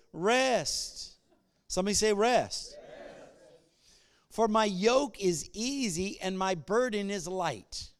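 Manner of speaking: loud, impassioned scripture quote; fervent preaching. Acted. Preaching scripture at full force.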